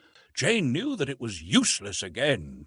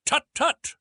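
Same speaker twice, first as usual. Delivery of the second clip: high, sharp tut-tut; fussy old professor; 6 dB louder than usual. A narrator's reading. Professor reacting to the stranger, high fussy cry. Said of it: "cried Professor Porter".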